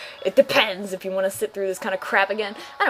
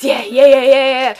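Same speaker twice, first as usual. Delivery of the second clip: cheering; 3 semitones higher, 9 dB louder than usual. Pretend. Cheering before seeing Snorlax.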